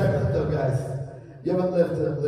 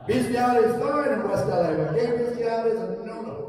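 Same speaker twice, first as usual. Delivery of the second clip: ordinary talk, nothing marked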